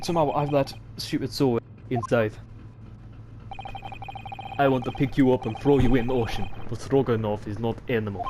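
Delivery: put-on gruff Russian accent. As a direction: threaten like a Russian brute. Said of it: "I like to do Russian accents".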